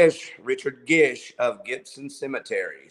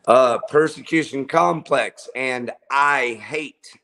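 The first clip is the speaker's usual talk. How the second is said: ordinary talk, nothing marked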